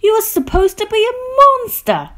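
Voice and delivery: high, loud exasperated shout. A narrator's reading. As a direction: shout it in shrieking protest. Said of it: "shouted the group".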